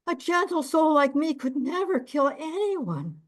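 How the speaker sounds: high, fawning self-defence; wheedling innkeeper. Acted. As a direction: play innocent, oily and high. Innkeeper defends himself in court.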